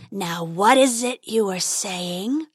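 slow menacing dragon voice. Acted. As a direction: toy with him like a beast.

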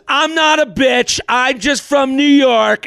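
hammed-up huffy New Yorker storming off; mock indignant shout. Angry exit line retold.

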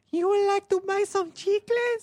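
put-on squeaky kid selling gum; Chicles kid voice. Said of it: "when I do the Chicles kid".

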